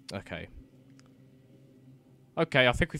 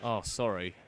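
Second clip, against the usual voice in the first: ordinary delivery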